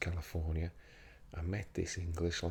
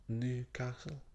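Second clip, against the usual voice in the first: Newcastle in a tentative Geordie accent, pitched up; second go at Geordie on Newcastle, higher; Geordie attempt; 5 semitones higher, slower than usual. Shown as a demonstration. Vlogger trying local pronunciation of Newcastle, higher unsure Geordie accent. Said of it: "I can't do that one".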